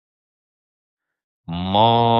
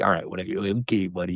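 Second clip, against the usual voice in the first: put-on softer, dismissive humoring of a child; mock indulgent adult; 6 dB quieter, faster than usual. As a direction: brush off a little kid's threat.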